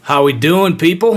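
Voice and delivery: southern accent. Real, not acted. Podcast host greeting listeners, slipping into a Southern accent. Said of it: "I have a southern accent all of a sudden".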